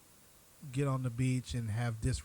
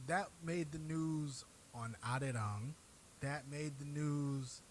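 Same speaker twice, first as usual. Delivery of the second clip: ordinary talk, nothing marked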